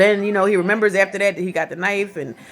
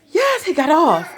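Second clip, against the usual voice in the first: retold gasp of relief; 9 semitones higher, 3 dB louder, slower than usual. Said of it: "First, I was like".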